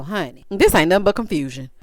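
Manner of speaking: ordinary talk, nothing marked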